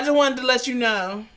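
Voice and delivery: ordinary talk, nothing marked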